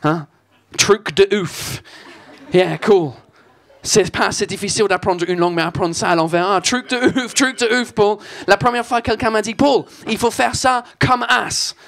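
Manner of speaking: French spoken with a thick put-on English accent. Comedian on stage hamming up an English accent. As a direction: play the Brit mangling French vowels. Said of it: "cet accent de merde".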